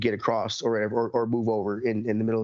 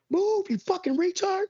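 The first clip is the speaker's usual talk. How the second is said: much higher and slow, hammed-up driver insult; mock road rage yell; slower than usual. Yelling at drivers in the car, hammed-up high road rage. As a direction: throw a high mock tantrum at the car ahead. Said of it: "I constantly yell at drivers".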